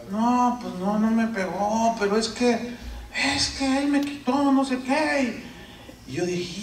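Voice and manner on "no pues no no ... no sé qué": put-on child explaining. Son explains to father.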